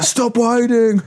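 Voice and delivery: angry mock Austrian yell, high and slow; hammed-up action-hero scolding, words stretched out; Arnold Schwarzenegger.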